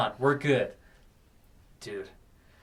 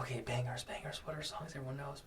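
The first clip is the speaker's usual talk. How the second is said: muttering to oneself; 18 dB quieter, faster than usual. Retold or imitated. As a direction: mutter it under your breath as you think. Thinking to himself while picking songs.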